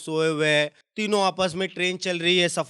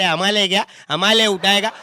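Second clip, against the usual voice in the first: louder sneering challenge; mocking tough retort; 8 dB louder than usual. One brawler sneers back at the other, louder. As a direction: dare him with a sneer.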